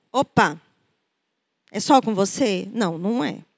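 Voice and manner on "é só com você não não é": ordinary talk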